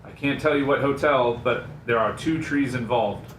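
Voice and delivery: ordinary delivery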